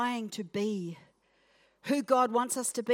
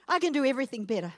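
mock boast; 2 semitones higher, much faster than usual. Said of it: "Remember, I'm playing".

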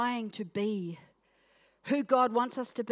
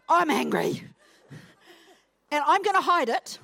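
mock anger; 5 semitones higher, 5 dB louder than usual.

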